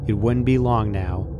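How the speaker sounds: usual speaking voice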